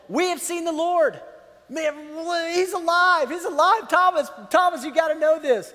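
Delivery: high, loud, breathless good news; bursting to tell a friend he's alive; excited. Retold or imitated. Disciples excitedly telling Thomas.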